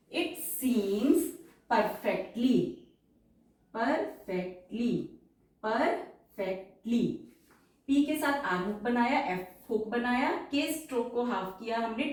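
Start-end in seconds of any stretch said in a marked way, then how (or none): 0.1-7.2 slow dictation. Shown as a demonstration. Shorthand dictation, words drawn out.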